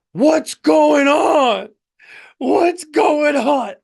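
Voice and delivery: high, slow, drawn-out mock shout; frightened passenger. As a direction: holler it from the back of the plane.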